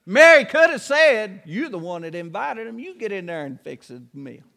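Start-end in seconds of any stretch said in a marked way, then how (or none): 1.5-4.4 much softer and lower, a muttered comeback under the breath; Mary's hushed retort. Acted. Mary quietly answering Martha back, soft and low. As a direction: keep it low so the guests won't hear.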